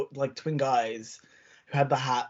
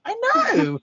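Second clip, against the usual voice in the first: very high, loud squeal; mock outraged agreement; 6 dB louder than usual. Contestant agreeing that judges don't understand, mock high squeal. Imitating reply to outraged presenter.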